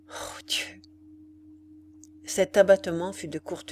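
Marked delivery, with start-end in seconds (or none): 0.1-0.8 broken, voiceless whispered sob; inarticulate sob. A narrator's reading. Ethel sobbing into her hands before her condemned lover. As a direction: choke the words out through tears. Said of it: "sanglota en disant d'une voix inarticulée".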